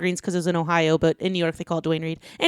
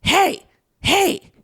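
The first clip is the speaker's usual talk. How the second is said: high-pitched "hey", twice, spaced out; imitating woman calling out; 12 semitones higher than usual. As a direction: mimic a stranger calling for attention. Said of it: "and I hear".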